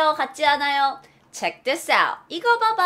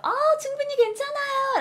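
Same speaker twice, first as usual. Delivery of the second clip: very high, sugary refusal; gushing polite "I'm fine"; sweet polite decline. Shown as a demonstration. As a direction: decline kindly in a pretty, lifted voice. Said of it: "말이 조금 더 예뻐지죠".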